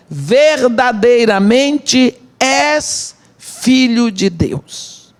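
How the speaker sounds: drawn-out, weighty proclamation, dropping at the end; solemn declaration. Retold or imitated. Preacher quoting the disciples' worship in the boat, solemn declaration. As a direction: stretch each word slowly in awe.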